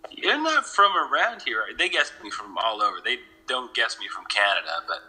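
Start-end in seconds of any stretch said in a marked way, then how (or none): 0.1-1.6 higher, louder mock local remark; imitating the locals. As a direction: quote a suspicious local, pitched up. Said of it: "they're like".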